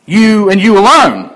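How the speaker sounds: loud emphatic "you alone"; emphasis on alone. Shown as a demonstration. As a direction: press "alone" home. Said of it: "Same emphatic word here".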